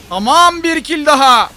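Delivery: loud, high shout of triumph; excited kill call. Real, not acted. Gamer announcing another kill, excited shout.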